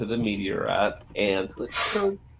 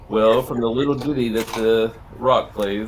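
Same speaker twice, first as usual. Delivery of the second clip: normal talking voice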